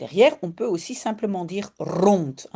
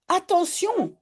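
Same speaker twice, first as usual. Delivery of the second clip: translated warning call; 10 semitones higher than usual. Acted. Teacher gives Janneke's warning to students in French, a high call. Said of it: "crie Janneke".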